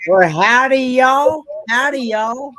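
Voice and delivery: loud, hearty Southern howdy; Southern accent. Shown as a demonstration. Showing off a Southern accent on request, loud and playful. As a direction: belt it out in a big Southern accent. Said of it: "Come on, you got a Southern accent".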